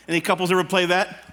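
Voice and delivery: ordinary delivery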